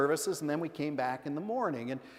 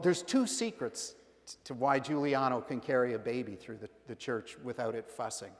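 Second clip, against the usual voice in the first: ordinary talk, nothing marked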